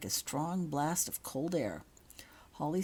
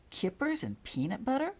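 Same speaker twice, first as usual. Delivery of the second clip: surprised question; 6 semitones higher than usual. A narrator's reading. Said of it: "asked Mark".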